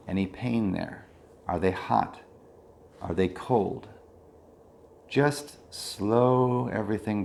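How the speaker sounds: unhurried meditation leader, easing down at phrase ends; guided meditation. Real, not acted. Leads a body scan meditation.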